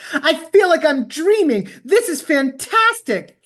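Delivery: high and slow with wonder; exhilarated. Retold or imitated. Ken running free on the beach, slow and exhilarated. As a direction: marvel at it, slow and lifted. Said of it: "yelp of sheer exhilaration".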